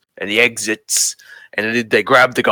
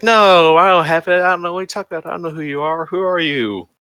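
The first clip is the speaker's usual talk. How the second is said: suspicious and coy; 7 semitones higher than usual. Retold or imitated. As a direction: play someone coyly denying everything. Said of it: "suspicious and coy".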